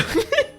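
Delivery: high laugh; burst of laughter. Real, not acted.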